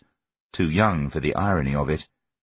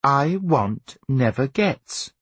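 slow, higher-pitched mother's rebuke; measured parental put-down; Viola; 6 semitones higher, much slower than usual. A narrator's reading. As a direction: recite it slowly at a demanding child. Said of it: "Viola said".

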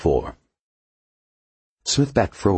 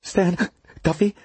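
slow, with a hiccup; slower than usual. A narrator's reading. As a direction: catch a hiccup mid-name. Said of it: "the engineer hiccuped".

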